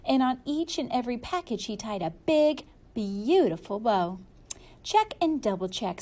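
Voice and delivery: plain reading voice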